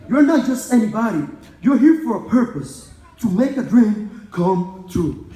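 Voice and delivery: high, loud, rousing pep talk; motivational speech. Retold or imitated. Graduate quoting a mentor's send-off to the crowd, motivational. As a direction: imitate a mentor firing you up. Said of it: "he ended with a motivational speech".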